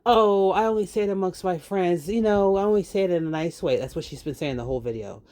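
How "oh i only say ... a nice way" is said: mocking quote. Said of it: "They don't just say".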